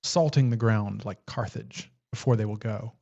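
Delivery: slow, measured poetry recitation; poem reading. A narrator's reading. Host reads a winter poem aloud, slow poem reading. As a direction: read the verse slowly, pausing at each phrase.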